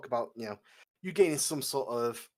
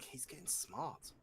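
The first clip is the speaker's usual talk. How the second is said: whispered aside; 15 dB quieter, slower than usual. Retold or imitated. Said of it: "Mira's like".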